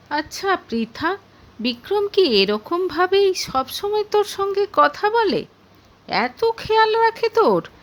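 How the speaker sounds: high, wondering questions to a friend; amazed questioning. Acted. Rimi asks Pritha about her attentive husband, amazed.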